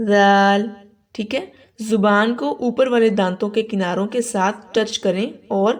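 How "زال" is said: زال with a soft lisped th as in "this", no whistle; soft dhal. Shown as a demonstration. Teacher models the letter for learners, soft unwhistled زال. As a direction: hold زال long, tongue lightly on the upper teeth. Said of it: "بالکل نرمی کے ساتھ، نہ سیٹی کی آواز ہو".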